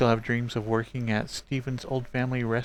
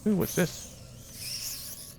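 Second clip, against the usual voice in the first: whispering; 6 semitones higher, 5 dB quieter, much slower than usual. Real, not acted. Curious find.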